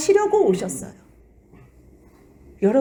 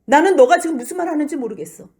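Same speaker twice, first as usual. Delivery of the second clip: denial; 7 semitones higher, 5 dB louder than usual. Acted. 모든 사람 앞에서 부인해요.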